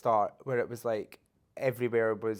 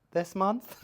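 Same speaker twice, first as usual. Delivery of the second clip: mock surprise; 8 semitones higher than usual. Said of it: "And I'm like".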